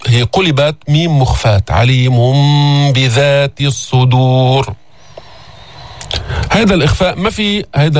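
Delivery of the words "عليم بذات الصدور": "عليم بذات" with a nasal hidden m before b, slow; hidden mim. Shown as a demonstration. Teacher repeats the iqlab example, slow and nasal. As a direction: hum a light m into "بذات". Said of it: "أخفينا هذه الميم عند الباء".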